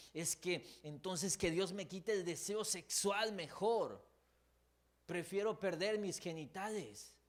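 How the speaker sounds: high, loud anguished plea, slow in places; mock anguished believer begging God; desperate believer. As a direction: plead like someone at the end of their rope. Voices desperate struggling believer.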